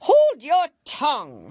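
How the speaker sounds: high, loud royal bark; furious Queen. Acted. Queen silencing Alice, loud and furious. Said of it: "said the Queen, turning purple".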